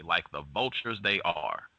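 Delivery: ordinary talk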